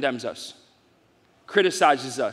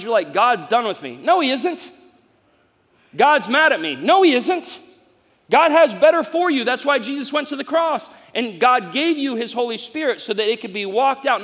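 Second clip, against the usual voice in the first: loud, high, fired-up preacher; full-force preaching; 6 semitones higher, 4 dB louder than usual. Acted. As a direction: preach it to the room and win an amen. Rising preaching climax.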